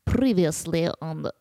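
put-on low, thick French accent; hammed-up French narrator voice; French narrator from SpongeBob. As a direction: announce a recap in a low mock French accent. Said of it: "do the French voice from SpongeBob".

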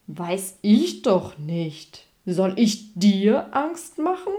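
slow, loud lion with a touch of amusement; mildly amused big-beast voice. A narrator's reading. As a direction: draw it out loudly, toying with the mouse. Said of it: "sagte der Löwe belustigt".

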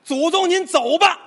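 high, slow, drawn-out call; loud ritual shout. Acted. Patient shouting his ancestors away on doctor's orders, loud. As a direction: call out loudly to send spirits away. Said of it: "大声喊".

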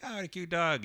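high, cooing delight. Retold or imitated. Greeting a little dog that licks him, cooing high. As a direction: coo over the puppy.

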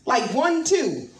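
ordinary talk

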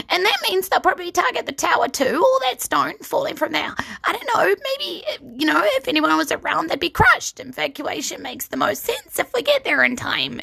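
high, nervous gnome chatter; rambling gnome. Acted. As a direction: ramble on anxiously. Rusty rambling while pacing.

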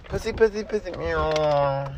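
high cooing call to a cat. Acted. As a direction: coo it to the kitty. Calling a cat.

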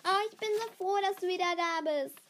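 high, loud rush of delight; exuberant, excited welcome; overjoyed. A narrator's reading. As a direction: gush with happiness at the reunion. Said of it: "stürmisch umarmte Hannah ihre Freundin".

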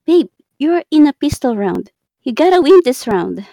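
loud, exasperated scolding. Real, not acted. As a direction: scold them firmly. Scolding partner.

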